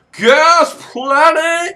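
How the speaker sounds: high, loud, very drawn-out holler; mock announcer. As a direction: stretch it out like a hammed-up title announcement. Playfully announces the game's location.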